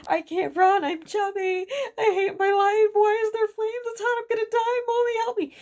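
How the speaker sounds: put-on high, loud, tearful child's cries; a small boy screaming for his mommy in the flames; panicked crying child. Four-year-old panicking in fire.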